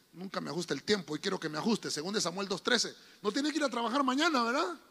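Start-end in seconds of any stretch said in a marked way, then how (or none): 3.2-4.8 light teasing question; playful jab at a listener. Acted. Teasing a congregant about leaving. (pregunta en broma)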